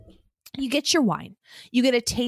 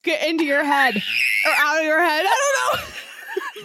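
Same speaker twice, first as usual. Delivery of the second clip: laughing in disgust; 8 semitones higher than usual. Real, not acted. Laughing in disgust while chewing.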